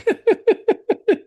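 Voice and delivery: very high, loud laugh. Real, not acted. Host amused by the extractor fan joke, high laugh. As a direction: burst out in a high cackle of laughter.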